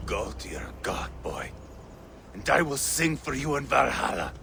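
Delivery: low, soft, grieving farewell; grieving whisper. Acted. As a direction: whisper it with grief. Father farewells dead son.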